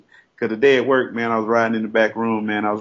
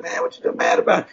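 high-pitched retort. Retold or imitated. Acting out his reply to friend.

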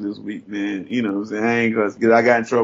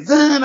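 high-pitched "zan" of sudden acceleration; quick vocal zoom effect; imitated scooter zoom; 4 dB louder than usual. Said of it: "I was just like".

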